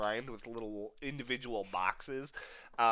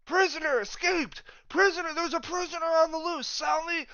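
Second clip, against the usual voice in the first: high, raised mock shout of alarm; alarmed guard yelling; 7 dB louder than usual. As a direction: yell the alarm as a panicked guard, rushing it. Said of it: "he starts yelling".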